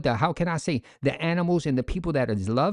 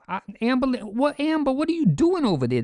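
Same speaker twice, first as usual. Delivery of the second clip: exasperated; 10 semitones higher than usual. Real, not acted. Exasperated outburst at Amber.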